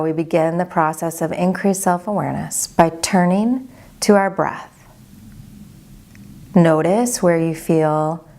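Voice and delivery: unhurried, even-toned body scan guidance; guided meditation. Acted. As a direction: guide them gently into calm, leaving space after each phrase.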